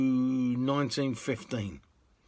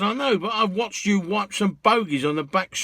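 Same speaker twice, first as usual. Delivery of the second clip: higher and louder, a knowing comeback; cheeky retort; 7 semitones higher, 6 dB louder than usual. Retold or imitated. Cabbie telling the passenger why he pays more, cheeky and raised. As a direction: play the cabbie scoring a point. Cabbie justifies extra charge.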